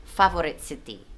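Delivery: Favorite said feɪ-vrit, clean English vowels; correct pronunciation. Shown as a demonstration. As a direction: model "Favorite city" the American way. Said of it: "words that he mispronounced".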